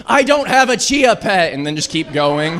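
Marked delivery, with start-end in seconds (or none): 0.1-1.5 high, slow, loud nonsense reply; high mock-serious answer; absurd deadpan. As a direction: baffle him on purpose. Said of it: "keep them struggling".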